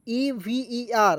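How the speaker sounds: E V E R spelled very slowly, letter by letter; teacher spelling out the word. Shown as a demonstration. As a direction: set each letter apart like dictation. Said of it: "इसे पढ़ेंगे दोस्तों".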